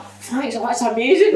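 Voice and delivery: lightly hammed-up thanks for a drink; imitated delighted women. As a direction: gush it in a high voice, laughing. Voicing women given cheap champagne.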